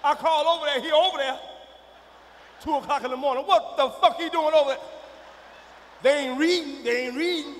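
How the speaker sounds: loud, exasperated protest; indignant outburst. Acted. Father ranting about the man at daughter's place, indignant outburst. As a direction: push it out loudly, outraged.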